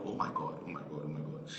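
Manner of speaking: very soft, repeated under the breath; retold dazed disbelief, fading away; stunned murmur. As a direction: repeat it quietly to yourself in shock. Retelling stunned reaction on phone.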